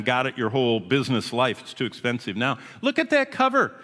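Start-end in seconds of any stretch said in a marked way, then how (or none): 2.8-3.7 excited exclamation. Real, not acted. Showing dramatic newspaper headline.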